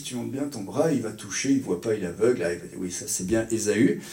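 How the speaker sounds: low, soft, conspiratorial coaching; hushed scheming mother. Acted. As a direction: murmur the plan so the blind father won't hear. Rebecca coaching Jacob in secret.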